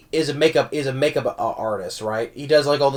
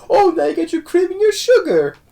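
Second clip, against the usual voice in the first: put-on much higher old lady falsetto; Mrs Doubtfire voice; 3 dB louder than usual. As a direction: chirp it like a prim old nanny. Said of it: "he's like".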